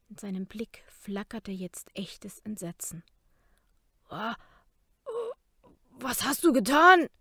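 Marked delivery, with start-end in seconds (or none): 4.1-7.1 loud terrified stammer; stammering in horror. A narrator's reading. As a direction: stumble over it, shocked and loud. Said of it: "stammelte er".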